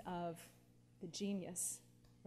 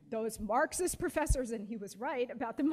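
usual speaking voice

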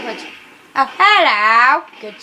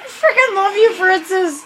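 hyper cheering; 6 semitones higher, slower than usual. Real, not acted. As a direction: gush over the character. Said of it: "really freaking hyper".

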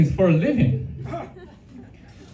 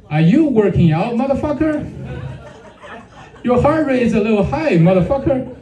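higher, lightly hammed-up smartwatch coach; Apple Watch voice; 6 semitones higher than usual. Watch scolds him mid-sandwich, raised mock coach voice. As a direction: play the watch nagging in a raised voice. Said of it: "my Apple Watch was like".

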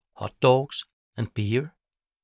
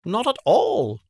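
much higher, louder bird voice; chirpy magpie; 13 semitones higher, 7 dB louder than usual. Acted. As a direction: chirp back at the witch. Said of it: "says Quip".

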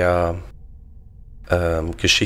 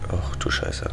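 whispering; 14 semitones lower, 6 dB quieter than usual. Real, not acted. Sneaking past the enemy.

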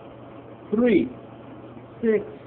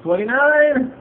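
high and loud; excited; 6 semitones higher, 5 dB louder, slower than usual. Real, not acted. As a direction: call it out thrilled. Finding another winner.

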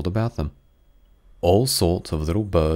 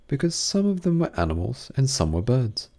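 ordinary delivery, nothing marked

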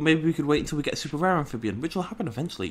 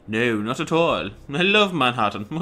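put-on posh accent; 4 dB louder than usual. Gamer replaying the Manhattan line after noticing the character, exaggerated posh accent. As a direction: draw the words out, very posh. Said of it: "this guy's really posh, isn't he?".

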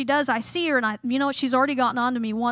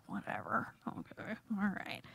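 low, muttered brush-off; grudging, under your breath; quiet, unbothered retort to a nagging boss; dismissive; 4 semitones lower, 16 dB quieter than usual. Retold or imitated. Brushing off the manager's orders.

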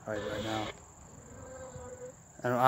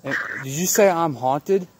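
excited disbelief; 7 semitones higher, 5 dB louder, slower than usual. Real, not acted. Reacting to a spirit box reply.

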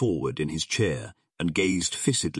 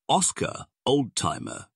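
drawled; 6 semitones higher than usual. A narrator's reading. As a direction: draw out the chummy address. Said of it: "drawled the big shot".

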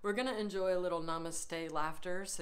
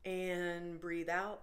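drawn out at half pace; slow breathing cue; much slower than usual. Shown as a demonstration. Yoga leader guiding the class's breath out, slow and drawn out. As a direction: stretch the words along with the breath.

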